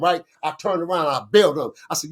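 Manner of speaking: usual speaking voice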